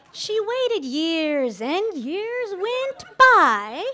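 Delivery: lilting fairy-tale poem read aloud to the guests; playful wedding-toast fairy tale recital; storybook rhyme reading. Acted.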